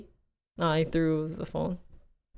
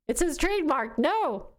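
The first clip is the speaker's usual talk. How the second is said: high playful refusal; 9 semitones higher than usual. Retold or imitated. Retelling her refusal.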